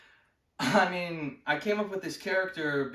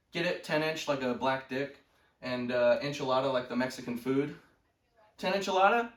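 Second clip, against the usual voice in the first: ordinary talk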